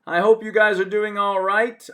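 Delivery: bright, loud, high welcome; loud greeting. Real, not acted. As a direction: call out a cheerful hello. Teacher greeting class loudly.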